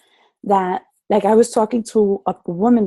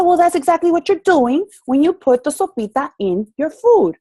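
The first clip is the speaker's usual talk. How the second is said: higher, louder, emphatic gotcha; pointed retort; 8 semitones higher, 4 dB louder than usual. Retold or imitated. Coach retelling how she told the woman off, higher and louder. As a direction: land the point with a raised, triumphant voice. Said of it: "I said".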